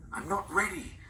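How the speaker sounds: high, breathless whispered plea; a frightened grandmother pleading not to go; terrified pleading. A narrator's reading. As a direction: beg not to be taken.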